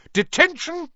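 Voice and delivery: stern teacher shouting detention. A narrator's reading. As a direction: bark it at the caught pupil. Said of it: "she shouted".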